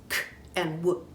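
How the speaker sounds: "k" and "w" as bare letter sounds; two separate sounds. Shown as a demonstration. Teacher splitting the duck sound, "k" and "w" sounded separately. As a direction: sound out "k", then "w", not the letter names. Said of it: "it's really made up of two sounds".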